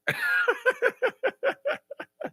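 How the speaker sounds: very high rising squeal of laughter. Real, not acted. Laughing at the answers.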